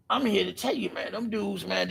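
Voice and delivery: usual speaking voice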